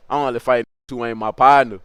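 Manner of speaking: usual speaking voice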